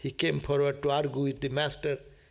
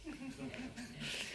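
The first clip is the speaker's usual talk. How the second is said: quiet breathy laugh; 9 semitones higher, 16 dB quieter than usual. Real, not acted. Laughing at Calcutta people joke.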